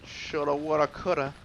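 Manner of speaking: loud, singsong "Shoulda, woulda, coulda"; sing-song regret. Real, not acted. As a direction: shrug off the mistake loudly. Gamer mocks own regret, louder.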